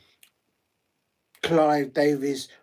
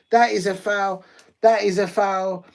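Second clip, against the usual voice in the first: foul call; 5 semitones higher, 6 dB louder than usual. Real, not acted. Calling a foul live.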